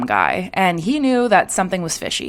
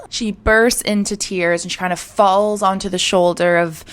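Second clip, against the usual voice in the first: plain reading voice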